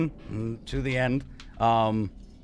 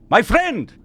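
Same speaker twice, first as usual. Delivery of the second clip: higher, louder call across the room; 14 semitones higher, 9 dB louder than usual. Acted. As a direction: shout to get attention. NPC calling players over.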